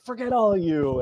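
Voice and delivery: high-pitched retold parting shot; mock defiance. Acted parting shot to rival bidders.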